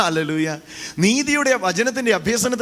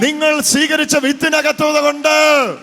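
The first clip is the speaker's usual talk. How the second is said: preaching shout; 6 semitones higher, 5 dB louder than usual. Real, not acted. Preaching at full force.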